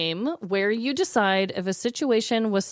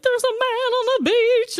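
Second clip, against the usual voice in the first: much higher, alarmed caller voice; mock scared caller; 13 semitones higher, 3 dB louder than usual. Host imitates callers reporting a man on the beach, in a high alarmed voice. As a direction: squeak it like a panicked local. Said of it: "they're like".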